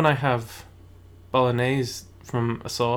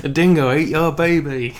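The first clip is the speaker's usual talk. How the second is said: loud, put-on Australian accent that drifts into cockney; a bad Aussie impression going cockney; mock Australian slipping into cockney; 3 semitones higher, 7 dB louder than usual. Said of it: "a weird cockney came in through there".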